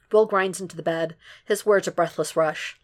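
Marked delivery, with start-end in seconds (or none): none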